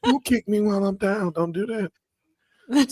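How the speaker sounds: mock [name] plea. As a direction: whine it up high like MJ. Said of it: "Sound like fucking [name]".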